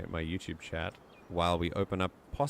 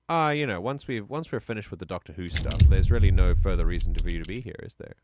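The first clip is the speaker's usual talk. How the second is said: teasing dismissal; 9 dB louder than usual. Pretend. Streamer joking that viewers have no reason to stay, teasing. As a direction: tease them as if showing them the door. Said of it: "I tease".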